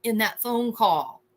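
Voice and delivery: ordinary talk, nothing marked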